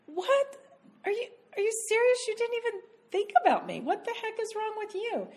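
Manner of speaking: high, fast, indignant mock rant; mock angry outburst. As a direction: imitate yourself getting offended, way up high. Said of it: "I got angry about something".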